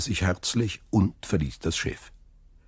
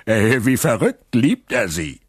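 higher, louder, laughing jeer; mocking laughter; 7 semitones higher, 5 dB louder than usual. A narrator's reading. Tailor laughs about Dantès's love to Danglars, mocking. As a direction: burst out laughing, then scoff.

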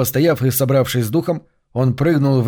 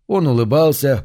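ordinary delivery, nothing marked

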